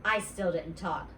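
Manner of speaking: plain reading voice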